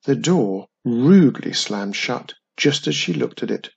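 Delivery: plain reading voice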